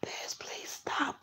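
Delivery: begging under her breath; frightened whisper. Retold or imitated. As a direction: whisper it slowly, pleading. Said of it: "She was like".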